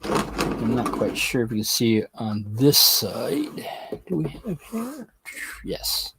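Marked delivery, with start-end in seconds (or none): 4.1-6.1 whisper. Real, not acted. Whispered question and answer.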